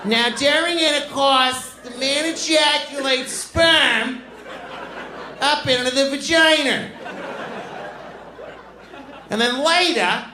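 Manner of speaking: mock fifth-grade teacher explaining the diagram in a high voice; Boston teacher impression. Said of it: "She's like".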